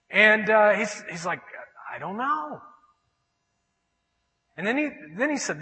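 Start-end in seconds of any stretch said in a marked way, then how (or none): 1.9-2.6 soft, higher-pitched helpless reply; sheepish answer. Retold or imitated. Friend answering God's question, sheepish and unsure.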